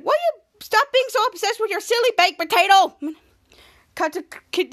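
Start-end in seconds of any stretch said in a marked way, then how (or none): 0.0-3.0 high-pitched mock telling-off; exasperated scolding. Frank Keeney in Captain Man's body scolding Goomer, exasperated. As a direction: scold like a fed-up cartoon character.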